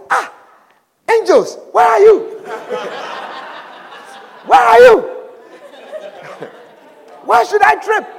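high, indignant demand at the angels; angry complaint. Acted. Tither who tripped scolds his angels: angry. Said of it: "he was very angry".

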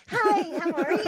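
high, loud fake woman's voice; mom impersonation. Teen phoning mom's girlfriends as her, high-voiced. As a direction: chirp it in a put-on female pitch. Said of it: "having me call her girlfriends".

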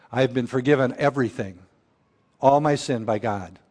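ordinary delivery

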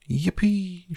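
put-on high, whooping old man's cheer; gleeful shout. A narrator's reading. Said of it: "shouted Grandpa Joe".

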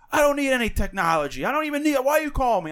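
loud, high-pitched imitation of an angry cold-call target; irate yelling customer. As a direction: yell it back like an annoyed customer. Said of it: "why is [name] yelling at that guy".

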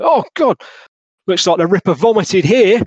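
high, loud shocked outburst; disgusted officer. Acted. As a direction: recoil in disgust at the mess. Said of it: "one of them's like".

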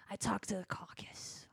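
secretive unvoiced whisper; hushed secret whisper. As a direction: whisper it like a conspiracy.

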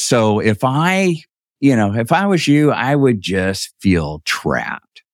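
deliberate, stretched-out punchline; slow right down to drive it home; slow, pointed refrain. Retold or imitated. Brother closing his earthquake argument, slow and pointed.

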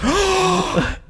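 high, dramatic mock gasp of shock; retelling a shocked gasp at the twist; big mock gasp. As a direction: gasp in thrilled surprise. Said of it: "And I was like".

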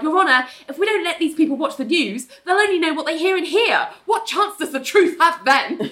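high, rushed courtroom protest; urgent objection. Retold or imitated. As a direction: butt in and plead your case fast. Richard interrupts to plead in court.

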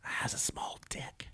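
hushed gossip whisper. Real, not acted. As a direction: whisper it like a secret. Gossiping a rumor.

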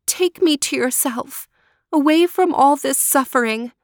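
put-on freezing girl praying to be taken away; prayer. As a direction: beg God for release, despairing. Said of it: "she prayed".

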